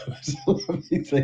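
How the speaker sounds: laughing. Real, not acted. Laughing at own repeated phrase.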